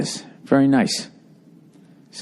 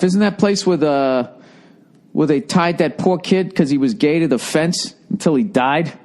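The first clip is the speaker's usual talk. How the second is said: usual speaking voice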